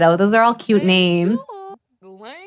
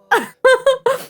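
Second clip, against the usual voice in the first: a burst of high laughter; high giggle. Real, not acted. Player laughing at her own fire story. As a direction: laugh it off, amused.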